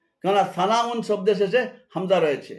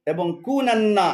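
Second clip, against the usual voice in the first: ordinary delivery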